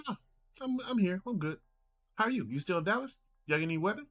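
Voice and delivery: very high, chipper uncle impression; high-pitched, unbothered uncle voice; uncle's tone. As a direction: brush off concern and ask back, imitating your uncle. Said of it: "The tone I'm giving you".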